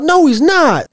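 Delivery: high mock yell of no. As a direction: blurt it out in a high fake yell. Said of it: "I just wanted to yell out".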